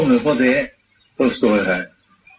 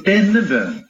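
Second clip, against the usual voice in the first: «denne» raised in pitch, falling after; stress on DENNE; 7 semitones higher than usual. Real, not acted. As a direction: lift «denne» to mark the contrast. Preacher corrects a misunderstanding. (tydelig trykk på «denne»)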